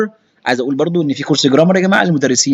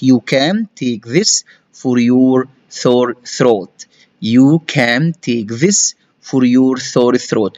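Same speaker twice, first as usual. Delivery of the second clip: slow model reading; much slower than usual. Shown as a demonstration. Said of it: "slow reading".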